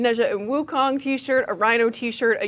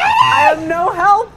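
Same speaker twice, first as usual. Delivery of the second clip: shouting; 7 semitones higher, 7 dB louder, slower than usual. Real, not acted. Excited search for hidden figures.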